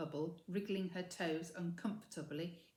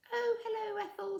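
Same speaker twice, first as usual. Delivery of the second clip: put-on higher, louder girl's grudging greeting; without enthusiasm; 6 dB louder than usual. A narrator's reading. Mildred greeting her rival Ethel, unenthusiastic. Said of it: "without enthusiasm".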